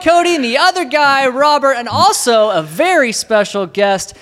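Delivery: high, loud, slow show opener; podcast host intro. Acted. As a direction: announce it like a radio host.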